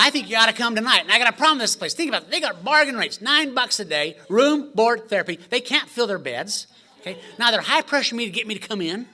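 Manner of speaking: loud, high, laughing sales push; laughing as he plays a pushy saleswoman; mock high-pressure pitch.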